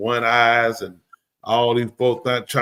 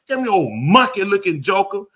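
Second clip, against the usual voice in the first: higher, punched-up mock insult; acted insult; 6 semitones higher than usual. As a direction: toss out a playful taunt with some bite. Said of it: "That's, like, offensive".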